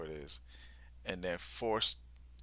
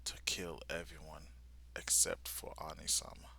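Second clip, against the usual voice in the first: low, soft, creepy menace; maniacal; 5 semitones lower, 7 dB quieter than usual. Retold or imitated. Villain announcing she will kill everyone, soft creepy menace. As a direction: hush it like a smiling threat. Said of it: "kind of maniacal".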